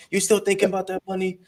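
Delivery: high, laughing disbelief; high-pitched mock surprise through a laugh; mock incredulous borrower. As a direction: play the borrower acting amazed it's still owed. Acting the borrower brushing off the lender.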